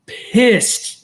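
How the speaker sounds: exaggerated pissed. Real, not acted. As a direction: stretch "Pissed" high, like fake fury. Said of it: "I was pissed".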